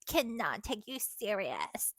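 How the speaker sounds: slow, mocking singsong of her words. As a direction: sneer her words back slowly.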